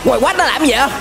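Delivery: ordinary delivery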